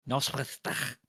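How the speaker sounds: exaggerated throaty Welsh pronunciation; phlegmy Welsh. Shown as a demonstration. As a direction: hawk up phlegm on the final sound of "Noswaith Dda". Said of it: "have phlegm in your throat".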